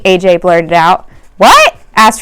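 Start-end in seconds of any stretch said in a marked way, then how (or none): 1.4-1.8 much higher, louder startled question; surprised what. Acted. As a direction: caught off guard by a rude rhyme. Teacher surprised by rhyme.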